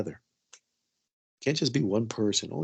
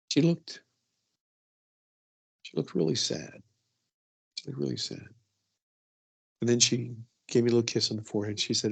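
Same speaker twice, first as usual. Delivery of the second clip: ordinary talk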